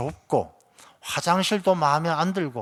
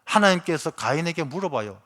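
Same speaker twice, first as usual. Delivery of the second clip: ordinary talk, nothing marked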